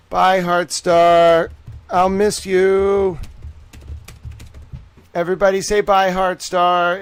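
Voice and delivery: loud, drawn-out gloating goodbye; loud mocking singsong farewell. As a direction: stretch it out in a big, slow, fake-sorry send-off. Mock farewell to banned troll.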